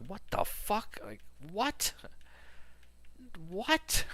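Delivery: high, rising stunned repeat of what; shocked disbelief. Retold or imitated. Hearing his friend's news, recalling his own stunned high reaction. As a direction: voice jumps way up in disbelief. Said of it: "I'm like".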